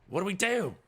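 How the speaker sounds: high, loud character voice; worried Scooter. Acted. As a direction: ask it high and helpless. Scooter at a loss, in game.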